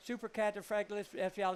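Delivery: Supercalifragilisticexpialidocious rushed out fast in one breath; rattled off fast. Shown as a demonstration. Commentator showing off his big word, said fast. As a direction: race through the long word. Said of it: "Okay, I'm gonna say a word".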